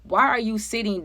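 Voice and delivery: usual speaking voice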